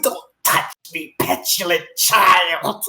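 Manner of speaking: slow, disdainful drawl; haughty snake. Acted. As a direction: look down your nose at the child. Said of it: "She's like".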